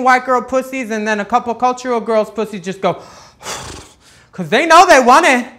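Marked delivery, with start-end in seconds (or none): none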